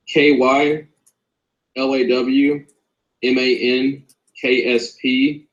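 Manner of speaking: slow, spaced-out letters for someone writing them down. Real, not acted. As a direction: spell it out slowly, pausing between groups of letters. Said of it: "say that again slower".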